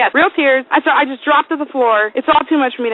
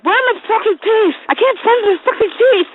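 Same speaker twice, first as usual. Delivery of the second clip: high, frantic toothless spluttering; spluttering mock yell; 9 semitones higher, 4 dB louder than usual. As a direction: yell it high, spitting the words out. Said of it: "fucking spitting everywhere".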